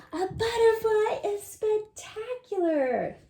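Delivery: high, awed read-aloud of the heading; reading to children. Acted. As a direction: announce the page title with wonder.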